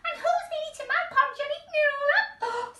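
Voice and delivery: put-on high, fast, tearful baby bear; a little bear about to burst into tears; Baby Bear crying. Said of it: "starting to cry".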